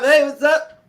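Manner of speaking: high, loud mock smooth hello; smooth greeting. Imagining greeting ladies at the party, hammed-up high hello. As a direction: ham up a pickup greeting to the ladies.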